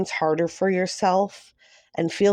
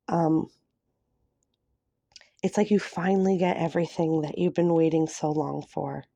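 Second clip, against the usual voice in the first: ordinary talk, nothing marked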